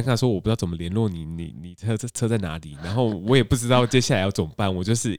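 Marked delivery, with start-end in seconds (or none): none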